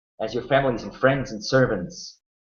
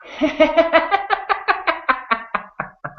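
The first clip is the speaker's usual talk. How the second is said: high mad cackle; maniacal laugh. Acted. Half-elf laughing as the stables burn, high maniacal cackle. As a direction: cackle like a madman. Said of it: "The half-elf laughs".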